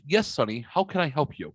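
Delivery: ordinary talk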